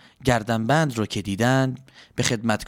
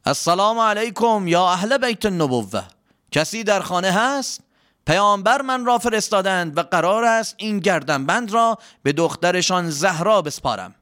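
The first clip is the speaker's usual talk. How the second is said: higher-pitched announcement at the door; lightly raised voice, announcing himself; calling out; 6 semitones higher than usual. A narrator's reading. Servant greets the Prophet's household from the door, calling out. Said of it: "با صدای بلند گفت".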